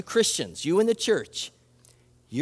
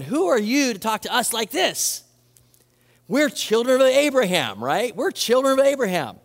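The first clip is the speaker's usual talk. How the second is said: raised, higher indignant protest; 5 semitones higher, 3 dB louder than usual. Retold or imitated. Pharisees protesting to Jesus, raised and indignant. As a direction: throw it back at him, offended and loud.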